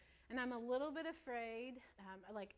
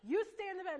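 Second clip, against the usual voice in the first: stern order; 7 semitones higher, 8 dB louder than usual. Retold or imitated. Mom ordering toddler to bed.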